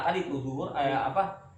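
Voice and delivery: normal talking voice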